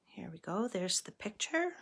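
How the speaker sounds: ordinary delivery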